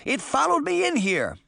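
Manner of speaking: high, defensive protest; high-pitched wail of excuse; crying out. A narrator's reading. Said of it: "Sabrina cried".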